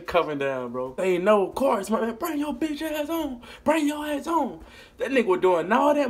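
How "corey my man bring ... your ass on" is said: taunting call. Retold or imitated. Men taunting them.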